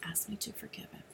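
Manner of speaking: very soft, near-whispered retelling; hushed inner prompting. Retold or imitated. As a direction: let it drop to a hushed, reverent near-whisper. Inner voice of God, retold.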